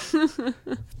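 high coughing breaking into laugh; cough, then laugh it off; coughing fit. Real, not acted. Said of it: "Oh, je m'étouffe". (toux qui tourne en rire)

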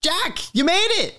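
very high, squealed welcome; thrilled high-pitched hello; Jamie's excited greeting. Acted. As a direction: greet a long-missed buddy, pitch way up. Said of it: "And he goes".

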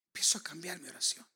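fast, unvoiced whisper. Preacher whispering to the congregation about his prayer. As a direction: breathe the line out as a secret. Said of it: "repeated phrase, whispered".

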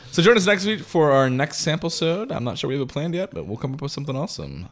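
show sign-off. Acted. Host closing the show for listeners, announcer outro. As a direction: wrap up the episode like a radio host.

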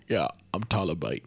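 low, soft British accent impression; mock British wrestler introducing himself; Tyler Bate. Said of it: "He's like".

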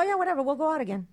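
ordinary delivery

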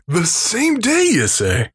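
mock incredulous echo.

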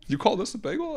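co-host echoes the bagel snob's outrage; mock New York complaint. Joke New York bagel bit.

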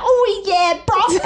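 hammed-up dumb bro accent; jokey exaggerated American drawl; mock American accent. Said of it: "make fun of his accent".

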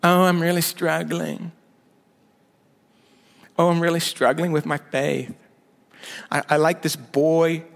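lightly voiced whiny believer; mock-whining about struggles; whiny struggler. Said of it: "I sound like it sometimes".

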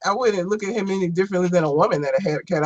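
ordinary talk

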